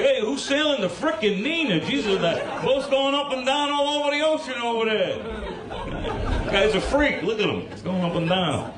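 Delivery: a sailor griping in thick New York; Christopher Columbus. Retold or imitated. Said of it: "unless Christopher Columbus spoke that way".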